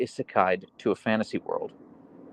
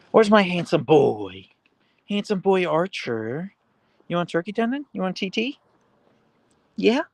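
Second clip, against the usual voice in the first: pet talk; 8 semitones higher than usual. Acted. Streamer offering her dog a turkey tendon, high coax.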